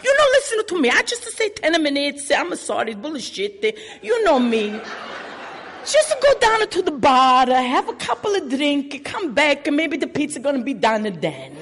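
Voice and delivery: lightly voiced Italian-American accent, chatty; a hint of the old Italian boss; Italian owner impression. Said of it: "He's like".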